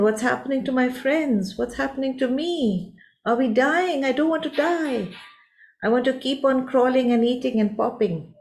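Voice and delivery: high, anxious little-creature voice; frightened caterpillar. A narrator's reading. Caterpillar sees its friends hanging, frightened and high. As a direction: pitch it up like a scared child. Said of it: "And you get frightened".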